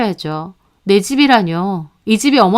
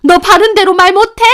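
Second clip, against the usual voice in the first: much higher, louder, furious shout; shouting at son; 9 semitones higher, 6 dB louder than usual. A narrator's reading. Mother shouting at her son to confess, furious shout. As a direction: yell at him to tell the truth. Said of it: "남편한테 소리를 지르셨어요".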